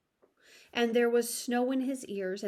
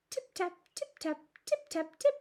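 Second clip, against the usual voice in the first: tiny tapping; 8 semitones higher than usual. A narrator's reading. Mice tapping under teacups.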